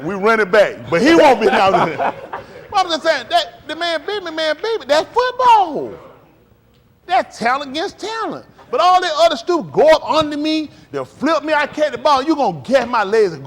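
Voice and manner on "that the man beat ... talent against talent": usual speaking voice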